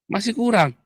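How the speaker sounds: a high, exasperated jab; annoyed outburst. Real, not acted. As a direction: toss it up high in disbelief. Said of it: "sampai saya jengkel". (kesal dengan nada tinggi)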